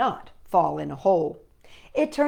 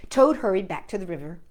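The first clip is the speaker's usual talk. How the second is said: ordinary delivery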